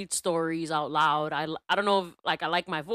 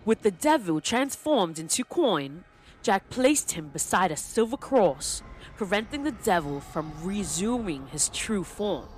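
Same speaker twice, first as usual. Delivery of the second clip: put-on theatrical storyteller in a loose British accent. Telling the Jack-o'-lantern legend, theatrical loose British accent. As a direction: tell it grandly in a British accent, pausing between phrases. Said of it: "I did, like, a loose accent".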